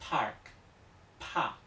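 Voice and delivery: PARK and PA with a puff after the p; breathy burst opening PARK, then PA; aspirated English p. Shown as a demonstration. Teacher likens it to English, aspirated PARK, PA. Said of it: "como en inglés".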